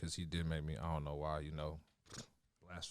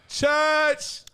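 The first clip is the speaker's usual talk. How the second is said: hype shout; 9 dB louder than usual. Real, not acted. Hype call-out agreeing.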